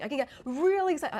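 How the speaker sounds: high, loud burst of excitement; loud, excited. Shown as a demonstration. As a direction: get loud and excited, short of shouting. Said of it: "the volume of which I can speak in".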